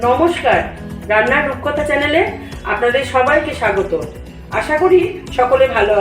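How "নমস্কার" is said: normal talking voice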